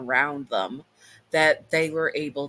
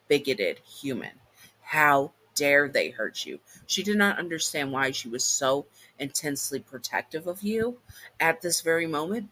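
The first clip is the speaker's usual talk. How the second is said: ordinary delivery, nothing marked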